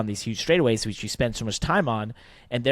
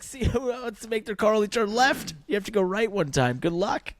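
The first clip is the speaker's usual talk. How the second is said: higher, teasing dare to the teams; mocking taunt; 11 semitones higher than usual. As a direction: taunt them in a raised, cheeky voice. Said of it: "I was like".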